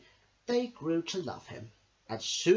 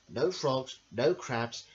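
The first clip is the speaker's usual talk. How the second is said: plain reading voice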